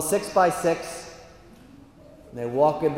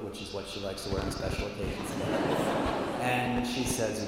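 ordinary talk

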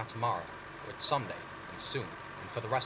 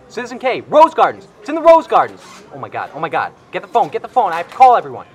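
high, loud, frantic shouting; gobsmacked; 9 semitones higher, 20 dB louder than usual. Acted. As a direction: shout it out in shock. Sudden realization about the tickets.

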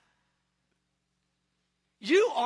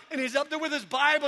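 ordinary talk, nothing marked

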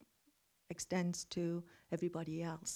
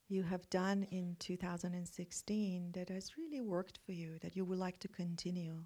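guided meditation. Acted. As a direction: guide them into calm reflection.

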